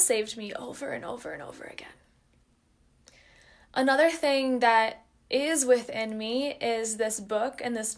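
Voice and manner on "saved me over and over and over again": whispered, choked with real feeling; emotional whisper. Real, not acted. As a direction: breathe it out, moved almost to tears. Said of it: "I feel emotional right now".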